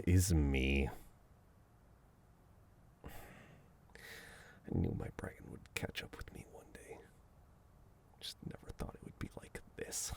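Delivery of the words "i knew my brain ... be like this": unvoiced aside muttered to himself; whispered aside. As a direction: whisper it as if the listener shouldn't hear.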